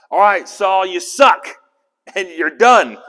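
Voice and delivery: hammed-up prophet laying down the verdict; mock verdict. Teacher voicing Samuel telling Saul he's done, loud and laughing.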